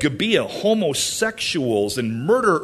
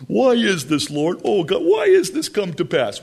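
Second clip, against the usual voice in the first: mock lament; 4 semitones higher, 3 dB louder than usual. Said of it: "But God".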